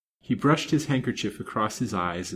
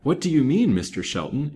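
high, loud, startled question; 6 semitones higher, 5 dB louder than usual. A narrator's reading. Banker questions the detective's hint of joy, startled. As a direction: jump on the first words in surprise. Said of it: "inquired the banker with a start".

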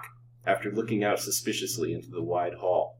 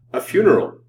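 loud, high startled exclamation; 8 semitones higher, 11 dB louder than usual. A narrator's reading. Man hears from his friend of a funeral, startled. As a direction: jolt with shock, voice leaping up. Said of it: "started and grew pale".

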